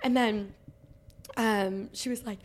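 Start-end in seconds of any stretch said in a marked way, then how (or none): none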